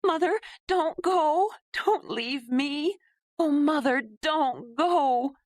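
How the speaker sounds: high, slow anguished wail. Acted. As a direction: beg her not to leave, wailing. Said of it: "at Ayla's wail".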